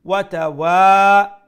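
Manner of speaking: ওয়া তাওয়া chanted loud, final wa held; one alif long. Shown as a demonstration. Teacher demonstrating madd, loud long last vowel on তাওয়া. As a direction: keep the start short, stretch the end of তাওয়া. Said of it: "এক আলিফ লম্বা".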